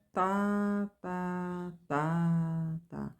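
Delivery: accent the first "tá" of four; strong first beat. Shown as a demonstration. Teacher chanting the four-beat rhythm, first tá accented. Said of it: "Na linguagem rítmica".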